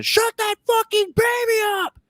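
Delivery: very high strained mock scream; acting out a woman yelling at full stretch; imitated furious yell. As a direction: screech it like an irate moviegoer. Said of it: "yells as loud as she can".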